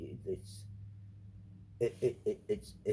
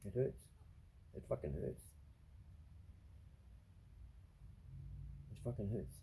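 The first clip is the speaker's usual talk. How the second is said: real hurt; 14 dB quieter than usual. Real, not acted. Confessing painful feelings.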